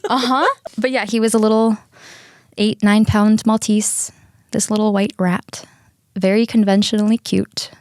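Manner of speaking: ordinary talk, nothing marked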